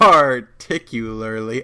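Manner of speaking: "particularly" slowly, syllable by syllable; careful attempt. Shown as a demonstration. Host told to say it on the podcast, slow, careful "particularly". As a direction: sound out "particularly" with great care. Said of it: "say it".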